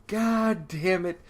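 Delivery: slow, high mock curse; exasperated groan. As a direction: draw it out in retold dismay. Crowd's dismay acted once more.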